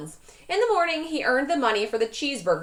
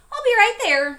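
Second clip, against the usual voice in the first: high, loud and eager; cried eagerly; 12 semitones higher, 8 dB louder than usual. A narrator's reading. Librarian cries out over the phone to Lewis. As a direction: rush it out. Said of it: "she cried".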